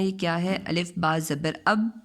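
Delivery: ordinary delivery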